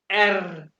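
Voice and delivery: "एर" loud, with the tongue vibrating on the r; rolled R. Shown as a demonstration. Teacher shows the German letter R, "एर" with rolled r. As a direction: trill the end of "एर". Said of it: "जुबान को vibrate करना है".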